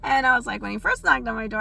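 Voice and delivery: ordinary talk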